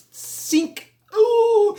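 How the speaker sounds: sharp hissed jab, then high loud "ooh"; imitated needle jab. Showing a mosquito going in like a needle, mimicked jab and yelp.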